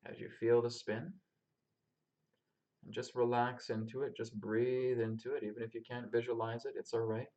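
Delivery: calm, unhurried meditation guide; grounding meditation. Acted. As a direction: lead them gently through the breath and body. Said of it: "we're gonna start off with a grounding meditation".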